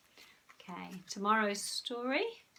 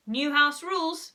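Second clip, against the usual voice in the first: louder, firm mother laying down the law; bossy Mum; 6 semitones higher, 10 dB louder than usual. A narrator's reading. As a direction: announce the rules like a strict mum. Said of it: "said Mum".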